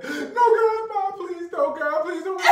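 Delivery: loud, very high mock crying; imitated hysterical pleading. Son at the bottom of the steps, mock hysterical begging. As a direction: wail like a terrified kid begging.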